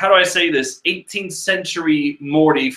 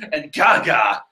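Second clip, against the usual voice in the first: normal talking voice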